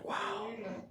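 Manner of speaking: normal talking voice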